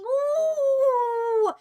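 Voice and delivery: loud, high, drawn-out "ooh"; high wail. Pretend. Streamer breaking off mid-word, high loud wail. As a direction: cut off into a long high hoot.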